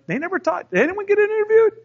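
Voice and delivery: high, loud incredulous coworker question. Retold or imitated. Coworkers marvelling at the award, high and incredulous. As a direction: ask it in rising disbelief. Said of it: "other employees were like".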